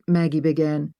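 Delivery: ordinary delivery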